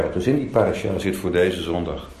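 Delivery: ordinary talk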